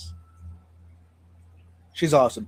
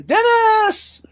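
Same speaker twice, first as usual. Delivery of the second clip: excited greeting; 13 semitones higher than usual. Real, not acted. Calling out a greeting.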